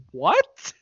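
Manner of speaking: very high, incredulous squeak; mock disbelief. Reacting to hearing he's just shy, mock disbelief. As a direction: shoot up high as if utterly baffled. Retells own baffled reaction.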